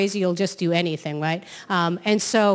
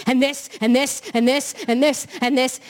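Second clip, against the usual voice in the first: frantic piled-up list, higher pitched; crazed New Yorker; 4 semitones higher than usual. Retold or imitated. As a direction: imitate someone manically adding complaint after complaint. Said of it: "I'm crazy at this point".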